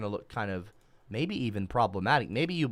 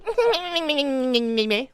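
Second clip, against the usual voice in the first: a squeaky laugh, well above the voice; high mocking laugh; 13 semitones higher, 6 dB louder than usual. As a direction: cackle at his expense. Laugh after insulting viewer.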